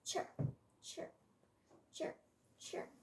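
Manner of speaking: tiny, soft separated chirps; short, quiet chirps with gaps; faint bird chirp. A narrator's reading. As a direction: imitate a baby sparrow peeping, very softly. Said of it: "The baby sparrows faint, but clear chirping".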